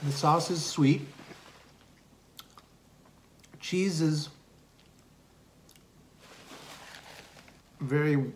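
chewing while talking; muffled full-mouth speech; mouth full of pizza. Real, not acted. Seen 2: Cheeks bulge and jaw works after a pizza bite while speaking.

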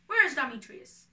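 hot-headed lover's shout; angry demand. Acted. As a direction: call out for the rival, ready to fight. Lysander demands his rival.

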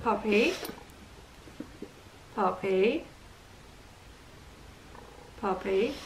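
low, soft call with a rising end; gentle calling. Real, not acted. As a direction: coax a puppy over quietly. Softly calling the puppy.

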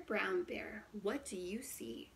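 ordinary delivery

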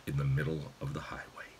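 very soft, low, quickened murmur; hushed narration. A narrator's reading. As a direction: drop almost to a whisper. Narrator hushes after the shell hits.

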